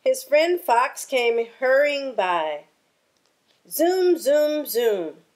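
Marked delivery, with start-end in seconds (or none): 3.7-5.2 whoosh by like a racer; zooming sound. Acted. Fox racing past.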